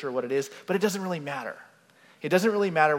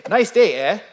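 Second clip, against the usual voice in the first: louder, higher mock Canadian eh; Canadian accent; 6 semitones higher, 12 dB louder than usual. Preacher mimics a Canadian greeting, loud playful accent. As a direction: play up the Canadian eh. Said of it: "you say".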